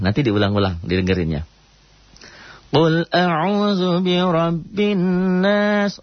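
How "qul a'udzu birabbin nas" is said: high, slow chanted recitation; Quran recitation demo. Shown as a demonstration. Teacher chanting the verse for students, slow and high. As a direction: draw out the Arabic like a reciting teacher. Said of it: "ro-nya tafhim ya".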